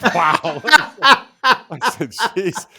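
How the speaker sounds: real laughter through loud high words; laughing disbelief. Real, not acted. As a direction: laugh while exclaiming. Laughing at the dark medical joke.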